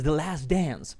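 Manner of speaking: English accent slipping into Spanish talk; native-style English accent. Shown as a demonstration. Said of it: "a veces me sale".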